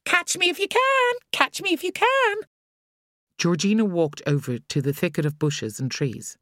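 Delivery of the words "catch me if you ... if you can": put-on much higher, gleeful child's chant; sing-song taunt in play; gleeful scream. A narrator's reading. Boy taunting friends in tag, gleeful. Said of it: "Cody was screaming gleefully".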